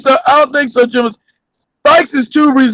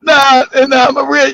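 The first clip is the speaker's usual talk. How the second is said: ordinary talk, nothing marked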